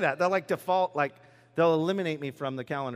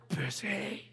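mock weary; 3 semitones lower, 5 dB quieter than usual. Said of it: "And then how's work?".

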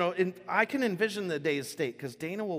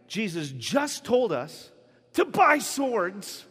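higher, louder mock eagerness; dazed disciples' excitement; 7 semitones higher, 5 dB louder, slower than usual. Half-asleep disciples deciding to use swords, mock excited realization. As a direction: build from slow realization to an excited outburst. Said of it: "what do they think? They're like".

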